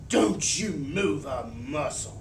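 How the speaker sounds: put-on a furious mother warning in a Southern accent. A narrator's reading. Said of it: "talking in that real Southern style accent".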